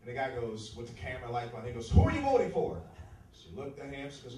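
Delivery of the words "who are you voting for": loud, high reporter's question; pushy TV reporter yelling at the door; reporter's shout. Retold or imitated. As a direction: bark the question at her.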